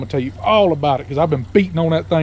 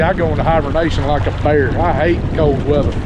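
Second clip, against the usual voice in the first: usual speaking voice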